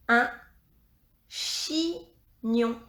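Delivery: "chignon" split in two, chi, pause, gnon; slow dictation of "chignon" with a gap inside; syllable by syllable. Shown as a demonstration.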